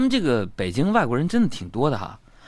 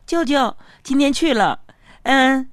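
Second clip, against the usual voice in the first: put-on child voice; 12 semitones higher, 3 dB louder than usual. Niece answers uncle.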